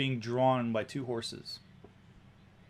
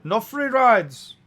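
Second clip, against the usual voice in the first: loud, high, insistent driver; woman carriage driver; 7 semitones higher, 10 dB louder than usual. Acted. Cab driver checking passengers can pay, loud high voice. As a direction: warn them loudly, high-pitched.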